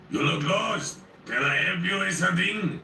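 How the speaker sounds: put-on booming French accent. A narrator's reading. Friendly giant greeting the narrator in a booming French accent. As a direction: boom it out in a cheerful French accent. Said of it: "booming French accented voice".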